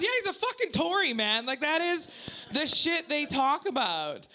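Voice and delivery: high-pitched, emphatic retort; exasperated boss. Retold or imitated. Boss explaining the Tory man to her, acted exasperation.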